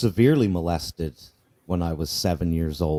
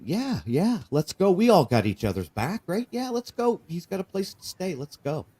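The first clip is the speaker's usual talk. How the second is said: put-on eager boys; 9 semitones higher than usual. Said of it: "the boys that were with me".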